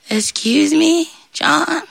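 high, slow, sheepish request; meek devil. Acted. Devil stuck in bush calls John, sheepish. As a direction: ask timidly, stretching the words out.

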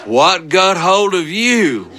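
high, drawn-out astonishment; mock shock at the sight of the kid; imitated startled dad. Imitating startled father seeing filthy son.

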